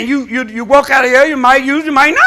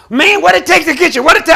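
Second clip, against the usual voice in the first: eager caller; 7 semitones higher, 7 dB louder than usual. Retold or imitated. Caller pleading with pastor.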